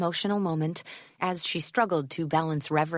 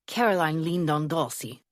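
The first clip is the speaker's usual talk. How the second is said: put-on old man with a thick German accent. A narrator's reading. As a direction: lean into a heavy German accent. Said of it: "in a thick German accent".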